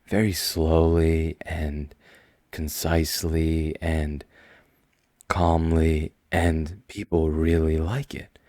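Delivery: slow, measured, calm delivery; slow calm demo. Shown as a demonstration. Demonstrating to listeners his slow calm classroom voice. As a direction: show the class how slowly and calmly he talks. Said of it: "I talk like this".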